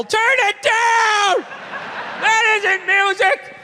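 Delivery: slow bellowing from a lonely basement tenant; grumpy old man yelling. Retold or imitated. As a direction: shout up at the noisy young neighbours. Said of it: "just screaming up at the younger couple".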